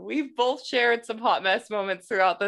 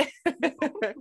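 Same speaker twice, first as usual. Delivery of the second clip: high laugh; 8 semitones higher than usual. Real, not acted. Laugh after excited outburst.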